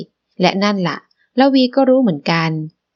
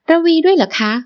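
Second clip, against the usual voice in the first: surprised question; 9 semitones higher than usual. Acted. Wife surprised another joins trip.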